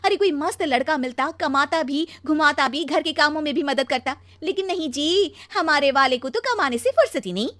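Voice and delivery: aggrieved complaining. Acted. Wife lamenting her wrong marriage, loudly aggrieved. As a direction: gripe with growing exasperation.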